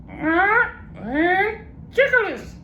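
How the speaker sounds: very high slow parrot garbling a long word. Acted.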